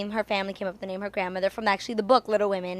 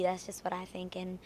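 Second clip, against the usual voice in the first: whispering; 2 semitones lower, 15 dB quieter than usual. Real, not acted. Soft closing aside.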